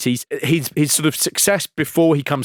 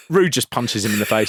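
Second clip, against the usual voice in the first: ordinary talk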